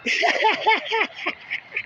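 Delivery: high, rapid chuckle; real laugh. Real, not acted. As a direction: chuckle in a quick high string. Speaker laughs mockingly at the people.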